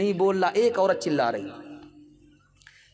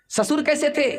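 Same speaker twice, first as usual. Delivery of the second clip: eager questioning women; 8 semitones higher than usual. Acted. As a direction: sing it out like curious aunts. Women of house ask.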